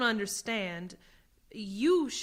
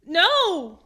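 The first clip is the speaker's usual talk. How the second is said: a sudden cry of no, loud and high; desperate shout; 8 semitones higher, 13 dB louder than usual. Acted. Accused prisoner cries out before the tribunal, panicked shout. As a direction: break out into a panicked yell. Accused refuses to face sentence.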